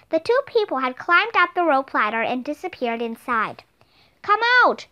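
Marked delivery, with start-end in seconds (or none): 4.2-4.9 higher yelled demand; yelling. A narrator's reading. Jack shouting up at the intruders, yelled demand. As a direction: yell up at the tree house. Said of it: "Jack yelled".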